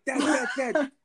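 high-pitched mock plea to dad; imitating a child. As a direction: call out like a pestering kid. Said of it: "And you're like".

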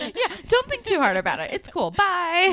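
loud, high, hammed-up brush-off; mock someone lying badly and rushing to leave; unconvincing reassurance. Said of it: "Your lies are not convincing".

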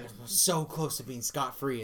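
ordinary talk

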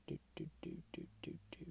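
soft whisper to self; breathy whispered humming; whispered doo doo doo. Real, not acted. Humming while checking site.

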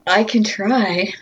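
slow, unsure "I can try"; hesitant. Real, not acted. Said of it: "Hesitantly, she says".

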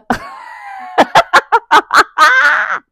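sudden high shrieking laugh at herself; loud burst of laughter. Real, not acted. Laughs at own blunder.

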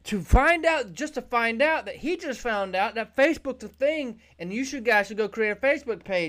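Zoom's pitch. Acted. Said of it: "Zoom jump on this mountain and say".

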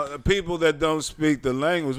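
usual speaking voice

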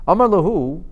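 ordinary talk

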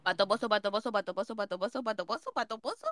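quick, soft tongue-twister run; repetición rápida. Real, not acted. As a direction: rattle it off without a break. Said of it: "Mira, hácelo rápido". (trabalenguas rápido y bajito)

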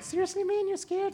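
very high squeaky falsetto; little guy. Retold or imitated. Little guy questioning the hulkiest soldier, squeaky little-guy voice. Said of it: "this little guy's like".

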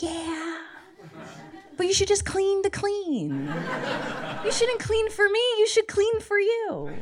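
a high, prim, preachy lecture on cleaning. Retold or imitated. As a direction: lecture him like a smug life coach. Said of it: "I was like".

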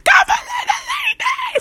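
very high, slow, crying shriek of the line; screamed lyric. Retold or imitated. Fan at a concert screaming the lyric, shrieking. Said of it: "Ben böyle ... falan diye çıkıyor".

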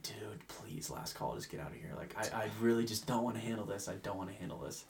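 low, very soft inner grumble; muttered plea. Retold or imitated. Bartender silently wishing customers would leave, muttered low. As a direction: plead quietly to yourself, voice sinking. Weary private plea before closing.

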